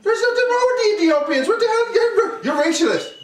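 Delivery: high-pitched laughing accusation. Real, not acted. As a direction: laugh it out while accusing him. Teasing accusation through laughter.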